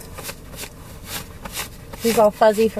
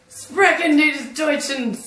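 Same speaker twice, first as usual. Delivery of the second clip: slow, high mock-German; fake German accent; 5 semitones higher, slower than usual. Friend asks for German, clumsy fake German accent. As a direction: badly fake German, drawn out and pitched up.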